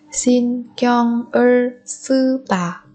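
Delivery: "신경을 쓰다" slowly, syllable by syllable; small pauses between syllables of "신경을 쓰다"; drawn-out teaching model of "신경을 쓰다". Shown as a demonstration. Teacher repeats phrase syllable by syllable.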